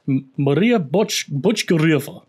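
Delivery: slow, halting stab at a Russian accent; attempted Russian accent. Shown as a demonstration. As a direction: feel out the name with a Russian accent. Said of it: "It's Russian".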